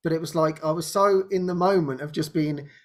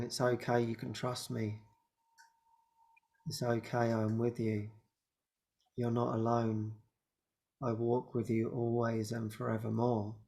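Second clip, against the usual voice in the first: softer, lower, gentle comforting voice; tender reassurance; 5 semitones lower, 10 dB quieter than usual. Pretend. Jesus reassuring the anxious speaker, soft and tender. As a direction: soothe her like a loving guide.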